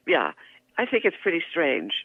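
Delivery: usual speaking voice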